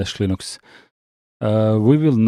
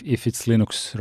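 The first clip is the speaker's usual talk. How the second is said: normal talking voice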